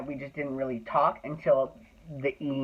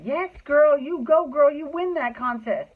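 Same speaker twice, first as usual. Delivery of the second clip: much higher and louder cheer; cheering hype; 10 semitones higher, 4 dB louder than usual. Retold or imitated. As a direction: hype up a friend backstage. Dancers cheering him on.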